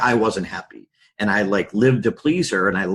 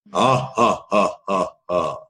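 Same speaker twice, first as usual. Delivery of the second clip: imitating a booming Seven Up commercial laugh; mock the coach's big slow ha ha ha; Mark Brown's big laugh; 3 semitones lower, slower than usual. Imitating the coach's booming laugh for the host. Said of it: "he's got a laugh like the guy from the old seven up commercial".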